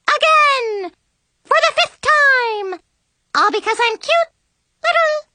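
squeaky high cartoon princess voice; very high, sugary chipmunk-on-helium princess. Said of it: "sounds like a chipmunk on helium".

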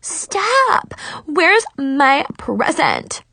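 furious high screech, drawn-out words; Mackenzie. A narrator's reading. Furious rival demanding her present, angry screech. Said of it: "screeched a furious Mackenzie".

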